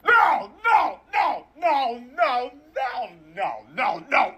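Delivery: high shouted repeated no. Acted. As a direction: yelp no over and over, fading. Impression, burning on stove.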